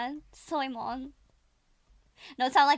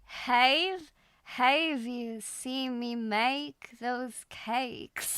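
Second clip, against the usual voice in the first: very slow, careful Southern drawl; Southern accent attempt; much slower than usual. Shown as a demonstration. Student echoing the coach's sentence, laboured Southern drawl. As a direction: copy a Southern drawl, vowel by vowel. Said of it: "it'll sound something like this".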